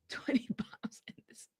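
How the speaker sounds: hushed, half-giggling whisper; whispered quote. Retold or imitated. Vlogger whispering the voicemail line to herself, hushed. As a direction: whisper it under your breath. Repeats the quote under her breath.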